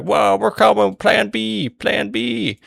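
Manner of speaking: very high, loud cartoon whoop; mock rallying cry. Voicing Sons of Ares flying to the rescue, high mock holler. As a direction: call it out in an exaggerated high voice.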